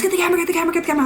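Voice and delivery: mock panic for the camera; urgent call. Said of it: "and he goes".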